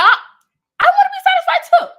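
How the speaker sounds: high, loud laughing cry; excited laughing shout. Real, not acted. Niece shouting to viewers she wants love, laughing. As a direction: squeal it out through a laugh.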